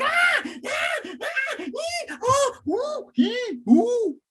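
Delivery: very high comic yelps and whimpers; string of squealed, exaggerated cries; mock wailing. Teacher reacting to being cursed out, hammed-up high wailing.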